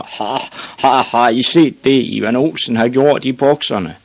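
hammed-up laughing taunt; rusty-voiced big boys. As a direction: crow it out like a mocking bully. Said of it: "med deres dybe, rustne stemmer".

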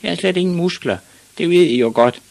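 put-on high-pitched little boy voice; high voice, defensive; protesting small boy. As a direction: protest like a picked-on child. Bullied Ivan protests to big boys.

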